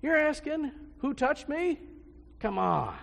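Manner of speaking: high, loud, exasperated disbelief; incredulous disciples. Retold or imitated. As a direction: scoff at Jesus as if it were obvious. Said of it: "he's like".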